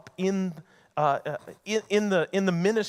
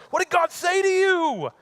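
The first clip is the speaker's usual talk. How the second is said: put-on much higher, louder, excited old man; eager Eli; 14 semitones higher, 7 dB louder than usual. Eli eagerly asks Samuel what God said, high and excited. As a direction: burst out eager to hear the news.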